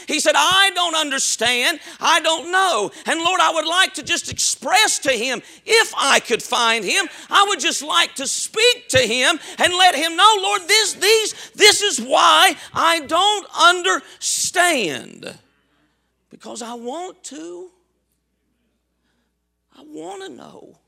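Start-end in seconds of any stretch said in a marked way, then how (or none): 0.3-15.4 raised, loud cry of not understanding; anguished plea. Acted. Sufferer crying to the Lord for answers, anguished. 16.3-20.8 suddenly soft, yearning to know; hushed plea. Acted. Sufferer who wants answers from God, hushed.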